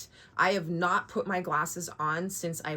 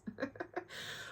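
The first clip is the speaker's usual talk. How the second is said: breathless giggle; 8 semitones higher, 15 dB quieter than usual. Real, not acted. Breathy laugh after joke.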